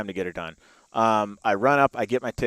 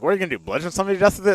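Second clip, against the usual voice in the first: scoffing rapid aside; 5 semitones higher, much faster than usual. Retold or imitated. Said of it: "It's just like".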